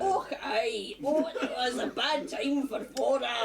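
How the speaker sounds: light Scottish impression of Ren; imitating Ren. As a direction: mimic Ren's Scottish lilt, laughing. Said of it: "Good impression".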